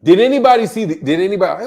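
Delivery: real yell, pitched well up. Real, not acted. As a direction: call it out loudly and high. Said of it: "I don't know why I'm yelling".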